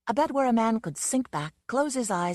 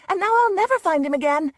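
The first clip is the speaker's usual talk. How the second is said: high, loud furious wailing; 12 semitones higher, 9 dB louder than usual. Acted. Susan blaming the man who scared her panther, furious loud wail. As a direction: wail it out in fury. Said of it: "Susan wailed furiously".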